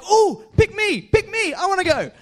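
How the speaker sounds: high, excited pick-me squeal; eager Donkey from Shrek. Retold or imitated. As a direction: bounce up begging to be chosen. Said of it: "It's like Donkey in Shrek".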